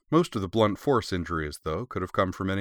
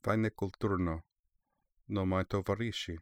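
softly murmured Russian, under the breath; hushed, understated aside in Russian; barely audible murmur; 7 dB quieter than usual. A narrator's reading. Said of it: "murmured in a barely audible voice".

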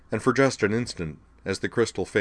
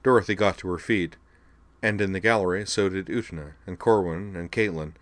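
usual speaking voice

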